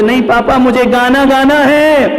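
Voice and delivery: put-on high, loud childish insisting; insistent child yell. Small boy yelling at father that he must sing.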